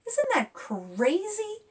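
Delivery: high incredulous question; amazed. Real, not acted. Author asking children about food waste, amazed. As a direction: sound truly amazed. Rhetorical outrage to kids.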